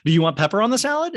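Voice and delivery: high, loud, quick server voice; chirpy mock server offering pepper; eager waitress. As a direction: pitch it like an actress waitress. Waitress acting out a scene.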